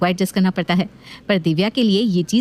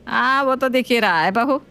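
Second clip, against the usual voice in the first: dry sarcasm; 4 semitones higher than usual. Acted. Mother-in-law dryly jabbing at the daughter-in-law. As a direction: drop it lightly and coolly.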